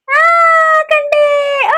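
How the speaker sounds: squealed high and loud; peekaboo reveal. Real, not acted. Mother finding her baby in peekaboo, squealing.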